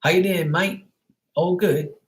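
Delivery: put-on British accent. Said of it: "you always hear British accents here".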